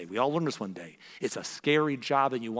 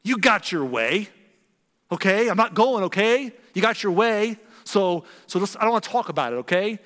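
put-on louder, higher bitter teenage retort; sulky teen snapping; 6 semitones higher, 6 dB louder than usual. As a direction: snap at mom like a resentful teen. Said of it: "I would say".

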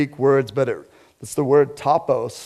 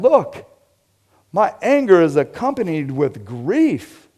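earnest Jesus rebuke; 5 semitones higher, 4 dB louder than usual. Acted. Jesus voiced rebuking the Pharisees.

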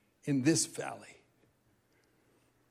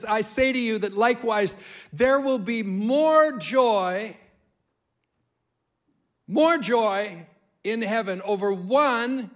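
1 emphatic quote; 3 semitones higher, 5 dB louder than usual. Acted. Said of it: "Jesus says this".

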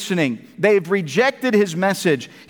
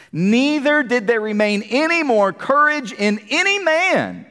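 scripture reading. Acted. Reads scripture aloud.

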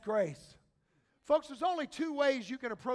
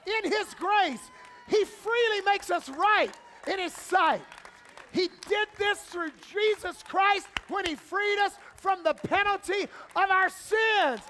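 loud, high, shouted sermon climax; full-force preaching; 6 semitones higher, 8 dB louder than usual. Acted. Pastor rallies the church to shout yet God, loud and fervent. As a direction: rouse the congregation to answer back.

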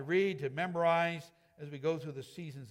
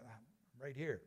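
much softer, lower, calm answer; gentle reassurance; 6 semitones lower, 13 dB quieter, slower than usual. Retold or imitated. God answers a frightened believer, soft and reassuring. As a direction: answer like a parent soothing a child.

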